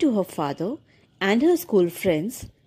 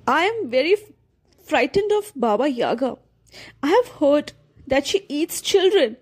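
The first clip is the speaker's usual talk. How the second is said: higher, tearful and frightened; tearful fear; 5 semitones higher than usual. A narrator's reading. Girl confessing fear to the mouse, tearful. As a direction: voice wobbling with fear. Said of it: "She started to cry".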